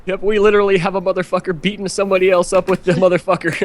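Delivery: hammed-up panicked report to the officer; fast high fake emergency voice; mock police call.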